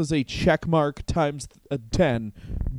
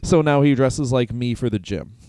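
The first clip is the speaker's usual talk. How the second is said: ordinary talk, nothing marked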